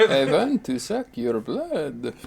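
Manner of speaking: put-on slow, lightly done Dracula accent; vampire accent. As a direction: drag it out like a movie vampire. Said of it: "the Russian accent".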